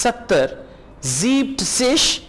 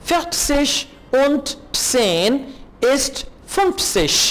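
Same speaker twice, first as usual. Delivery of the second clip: slow model reading; 4 semitones higher, much slower than usual. Shown as a demonstration. Slow German model phrase.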